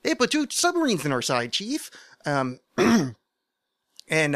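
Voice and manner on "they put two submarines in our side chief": high, loud mock Quint line; Quint line in host voice. Host repeats the Quint line in his high show voice. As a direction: fling the line up like a cheerful host. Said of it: "So I tried doing the whole".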